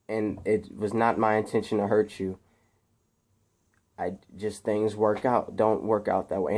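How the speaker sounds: low, soft, heartfelt farewell; quiet retold father's goodbye; sincere apology. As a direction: apologize gently, voice sinking. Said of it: "they really apologize".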